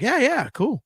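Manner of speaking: high, breezy mock agreement; easygoing yes. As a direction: imitate a cheerful, relaxed musician. Imitating the guitarist's easy reply.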